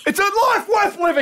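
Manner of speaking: very high-pitched pleading yell; hammed-up rescuer's cry; mock desperate shout. Pretend rescuer urging someone out of the water, mock desperate shout. Co-host acts the rescuer yelling.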